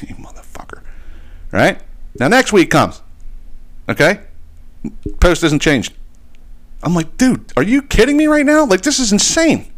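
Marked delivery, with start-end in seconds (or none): 0.0-0.8 breathless whisper under his breath; a voiceless whispered curse, retold. Muttered retort to client's excuse. 7.2-9.7 much higher, incredulous outburst; retelling his own high-pitched disbelief; exasperated disbelief. Retold or imitated. Retold exasperation at unchanged post.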